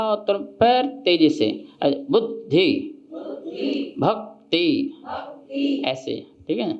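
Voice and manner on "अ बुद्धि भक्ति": बुद्धि with a short stop at द्ध, then rushing on; भक्ति, brief brake at क्त and then fast; catch on the double consonant. Shown as a demonstration. Teacher demonstrating the speed-breaker stop in बुद्धि. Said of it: "जैसे speed से गाड़ी चल रही हो, breaker आया".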